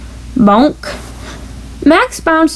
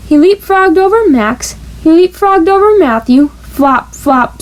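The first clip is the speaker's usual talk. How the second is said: plain reading voice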